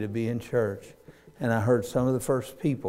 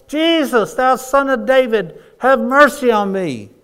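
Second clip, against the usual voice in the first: much higher, much louder desperate holler; 12 semitones higher, 11 dB louder than usual. Acted. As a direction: shout it out over the crowd for mercy. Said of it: "He hollers".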